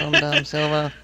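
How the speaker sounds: nerd voice. Retold or imitated. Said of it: "I'm a robot".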